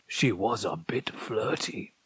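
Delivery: whispered confession, almost to himself; whispered aside. A narrator's reading. Old man remembering, whispering a secret aside. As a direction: let it slip under your breath.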